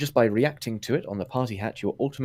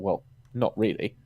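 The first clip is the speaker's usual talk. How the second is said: normal talking voice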